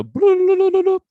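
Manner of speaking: very high, loud bloop noises; slot machine bloops. Shown as a demonstration. Showing how his mind works, high slot machine bloops. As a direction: chirp it like a spinning slot machine. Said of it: "So you go".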